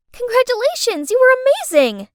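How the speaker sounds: high, bright cheer; excited cheer. Acted. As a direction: gush with high praise. Cheerful friend congratulating.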